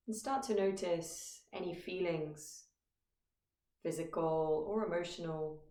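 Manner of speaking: calm, measured meditation guide with long pauses; guided meditation. Acted. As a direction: guide listeners gently into stillness, phrase by phrase. Leads a meditation.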